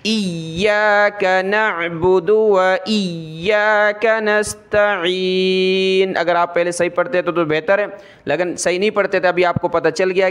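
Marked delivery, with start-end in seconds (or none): 0.0-6.2 slow melodic recitation with the یا doubled; Quranic recitation. Shown as a demonstration. Teacher reciting the Fatiha verse for pupils, slow melodic recitation. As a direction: recite the verse slowly, pressing the shadda. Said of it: "سورۃ الفاتحہ کی یہ آیت ہے".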